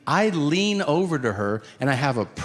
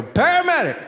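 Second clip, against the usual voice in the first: frantic; 12 semitones higher than usual. Retold or imitated. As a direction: yell it like it's urgent. Frantic call to 911.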